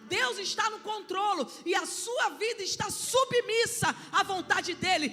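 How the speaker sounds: rising, loud preaching with shouted peaks; preaching at full force. Acted. Pastor preaching to the congregation, loud and fervent. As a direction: preach it out to the church, building.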